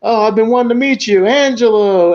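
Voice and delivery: high, loud star-struck mob boss; Don Marchese. Retold or imitated. Mob boss finally meeting Angelo, high-pitched put-on awe. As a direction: gush like a thrilled fan. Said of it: "He's playing, uh, Don Marchese".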